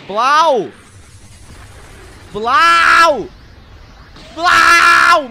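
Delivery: loud, high shouted explosion noise; blast sound effect. Retold or imitated. Imita o som da explosão. (explosão gritada)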